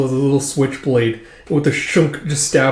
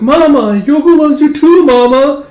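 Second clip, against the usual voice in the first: put-on high loud child voice, tearful; little girl; 13 semitones higher, 10 dB louder, slower than usual. Yoko telling Mama she loves her, high child voice. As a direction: speak like a small child saying goodbye. Child tells dying mother.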